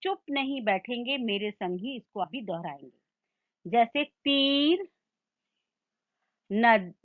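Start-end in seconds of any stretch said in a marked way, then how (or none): 4.0-4.9 "तीर" with a long, held ई; repeat-after-me demo. Shown as a demonstration. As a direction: stretch the vowel of "तीर" slowly for pupils to copy. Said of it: "आप भी मेरे संग संग दोहराइए".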